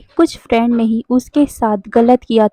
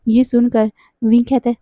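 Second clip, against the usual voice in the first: plain reading voice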